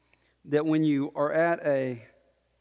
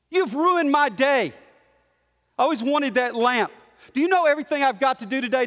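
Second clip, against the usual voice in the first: high, loud acted tirade; angry complaining parent; 7 semitones higher, 4 dB louder than usual. Parent berating Johnny over the broken lamp, loud angry complaining. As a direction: scold the child, outraged and piling up grievances. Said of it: "You're complaining".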